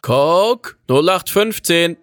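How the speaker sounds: high, loud summons; woman shouting. A narrator's reading. Woman in the registration hut summoning Coke, high shout. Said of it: "schrie eine Frauenstimme aus dem Anmeldehäuschen".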